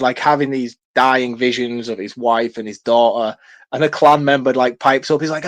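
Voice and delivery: ordinary talk, nothing marked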